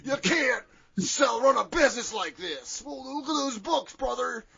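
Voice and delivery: high, loud wrestler growl; Hulk Hogan. Retold or imitated. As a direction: ham up the wrestler promo voice. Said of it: "Hogan defeats the bounty hunters and goes".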